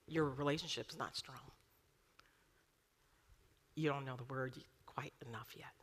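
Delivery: confiding whisper; whispered aside. Real, not acted. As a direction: lean in and whisper it.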